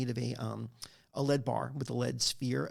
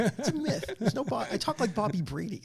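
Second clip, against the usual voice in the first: laughing; 8 semitones higher than usual. Real, not acted. Laughing denial of Boston accent.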